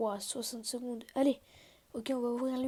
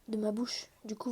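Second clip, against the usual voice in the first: ordinary talk, nothing marked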